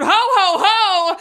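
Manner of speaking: loud, high Santa laugh; jolly ho ho ho. Pretend. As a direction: belt it like Santa. Holiday host's Santa laugh.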